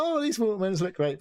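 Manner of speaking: high-pitched, gushing approval; mock delight. Woman buying the repainted watermelons, mock delighted gush.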